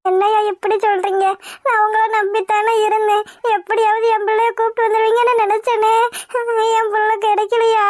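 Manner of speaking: crying through a wounded plea; acted weeping reproach; tearful despair. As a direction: break down as hope for your child goes. Mother breaks down at the bad news.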